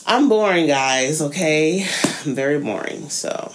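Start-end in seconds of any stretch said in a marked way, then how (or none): none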